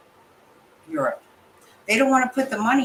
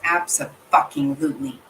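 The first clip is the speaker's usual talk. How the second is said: ordinary delivery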